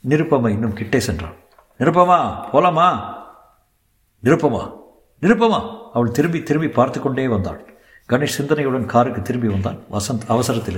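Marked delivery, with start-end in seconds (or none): 1.8-5.6 raised, louder call by name. Acted. Man calling his companion back to leave, insistent. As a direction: call her back, pitch jumping up.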